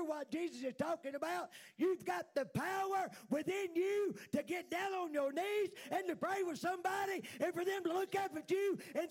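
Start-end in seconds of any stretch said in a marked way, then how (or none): 1.8-9.1 raised fervent preaching shout; preaching at full force. Real, not acted. Preaching climax.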